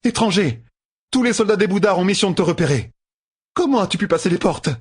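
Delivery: high, fast and urgent; alarmed. A narrator's reading. Katana fires urgent questions at Matt, high and fast. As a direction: press him in alarm.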